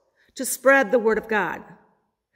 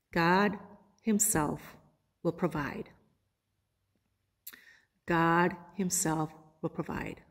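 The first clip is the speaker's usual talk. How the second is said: quiet reverent; 5 semitones lower, 8 dB quieter than usual. Acted. Abraham's reverent answer, repeated.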